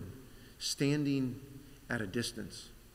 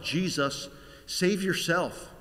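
compassionate urging; 6 semitones higher, 4 dB louder, slower than usual. Acted. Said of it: "not mockingly, but unknowingly and compassionately".

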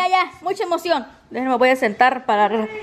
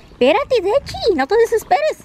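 higher, coaxing voice to a pet; high pet-talk pleading; talking to the dog; 10 semitones higher, 3 dB louder than usual. Real, not acted. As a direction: soothe the impatient dog, pitched up.

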